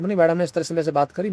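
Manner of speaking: usual speaking voice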